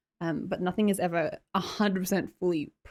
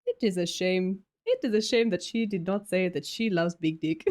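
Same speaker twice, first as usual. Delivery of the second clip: put-on deliberate, playful Nigerian accent. Streamer joking about the donation message, imitated Nigerian accent. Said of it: "Oh, Nigerian".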